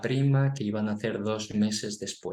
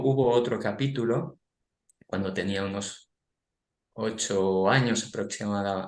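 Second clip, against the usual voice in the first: normal talking voice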